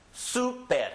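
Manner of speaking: Super as SOO-pehr, high first syllable; written-accent stress. Shown as a demonstration. Teacher models a word with written accent, Super stressed high on SU. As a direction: hit SU in Super, falling after. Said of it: "Has a written accent".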